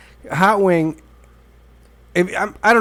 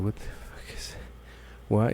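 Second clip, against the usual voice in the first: whispered alarm; 3 semitones lower, 13 dB quieter than usual. Retold or imitated. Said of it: "And I'm like".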